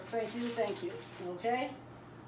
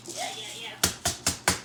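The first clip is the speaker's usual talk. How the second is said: squeaky yeah; 11 semitones higher, much slower than usual. Acted. Squeaky after the growl.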